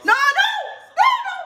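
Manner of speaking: high shrieking no's, laughing in panic; panicked laughing scream. Real, not acted. Fan watching Sierra Leone screams at the screen in laughing panic. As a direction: shriek it out high as the ball nears goal. Team nearly concedes, screaming.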